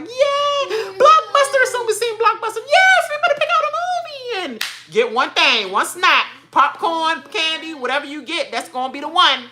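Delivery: very high, excited cheer; excited family. Retold or imitated. Family cheering at Blockbuster, squealing high. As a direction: squeal like a kid at the video store. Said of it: "everybody just be smiling like".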